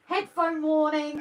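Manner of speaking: high, loud, slow warning call; announcing a warning. Real, not acted. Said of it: "warns viewers of loud noise".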